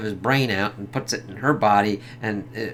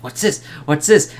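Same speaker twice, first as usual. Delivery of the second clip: curious mock amazement, pitched up; imitated wonder; 4 semitones higher, 6 dB louder than usual. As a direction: play Jack's puzzled discovery, building louder. Acts out Jack discovering Christmas Town.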